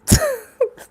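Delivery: helpless laugh; real giggle fit; high squealing giggle. Real, not acted. Giggle fit during reading.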